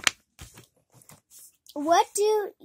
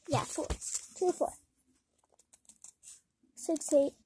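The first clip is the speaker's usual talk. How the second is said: ordinary talk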